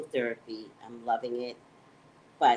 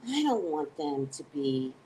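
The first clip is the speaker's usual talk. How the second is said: normal talking voice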